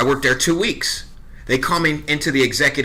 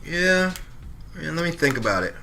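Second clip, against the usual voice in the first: a touch of playing it cool, quiet; mock cool; 4 semitones higher, 8 dB quieter, slower than usual. Offered ten thousand, pretending to be calm and quiet. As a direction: act unbothered. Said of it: "I was like".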